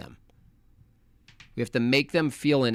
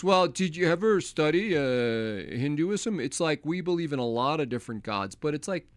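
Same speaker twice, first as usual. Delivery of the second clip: mock Vivek; 5 semitones higher than usual. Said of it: "He goes".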